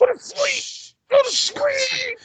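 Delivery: high, slow, breathy lullaby hush; whispering over a crib. Retold or imitated. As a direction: coo her to sleep way up high. Hushing the child to sleep.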